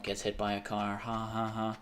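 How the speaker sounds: ordinary delivery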